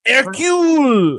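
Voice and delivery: high, loud, drawn-out cry; exasperated high yell; outraged correction. Real, not acted. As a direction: shout the right name in mock outrage. Correcting co-host's wrong detective name.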